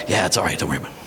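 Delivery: low, hushed brush-off; dismissive dad. Acted. Father reassuring his worried child, low and dismissive. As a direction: play a dad quietly waving it away.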